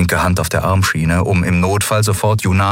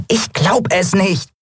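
hohes, quiekendes Piepsen, langsam; piepsend; 13 semitones higher, slower than usual. Acted. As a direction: piepst ungläubig wie ein kleines Wesen. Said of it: "piepste Nelen". (Nelen piepst fassungslos in hoher Stimme)

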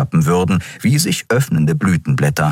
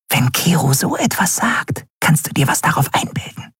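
higher, hushed voice sliding into a whisper; 9 semitones higher than usual. A narrator's reading. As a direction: whisper it in the boy's ear. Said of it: "flüsterte". (flüsternd, hoch und leise)

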